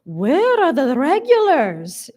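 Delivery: put-on high, slow Hungarian-accented wail; agitated, Hungarian accent. A narrator's reading. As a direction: draw the question out dramatically in thick Hungarian. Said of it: "gesticulating wildly".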